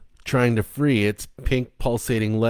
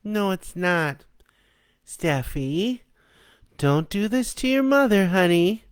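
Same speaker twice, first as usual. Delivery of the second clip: high, coaxing sweet pleading; coaxing mother; 11 semitones higher than usual. Acted. The mother thing coaxing Steffi not to betray her. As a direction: wheedle the child back.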